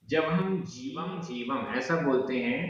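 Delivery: normal talking voice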